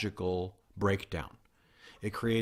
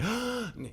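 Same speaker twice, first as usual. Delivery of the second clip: sharp gasp for air; 13 semitones higher than usual. Pretend. Pretends to run out of air.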